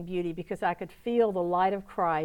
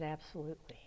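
very soft, low yes; hushed assent; 4 semitones lower, 14 dB quieter, faster than usual. Retold or imitated. Helper agreeing to a hug, very softly. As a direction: answer tenderly, barely above a murmur. Her tender answer to hug request.

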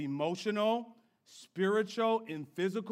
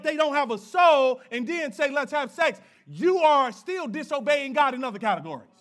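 loud, higher, driving pulpit delivery; rising sermon fervour; full force preaching; 6 semitones higher, 10 dB louder than usual. Acted. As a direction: preach it hard to the congregation.

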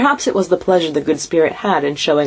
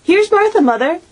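much higher, louder bright announcement; cheerful girl; 5 dB louder than usual. A narrator's reading. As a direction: pop in and announce yourself. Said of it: "said a girl".

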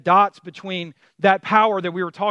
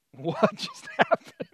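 higher, slow mock bewilderment breaking into laughter; stunned, laughing; 4 semitones higher, slower than usual. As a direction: laugh through the disciples' disbelief. Voicing stunned disciples, laughing.

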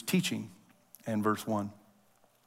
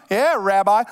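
mock taunt; 6 semitones higher, 6 dB louder than usual.

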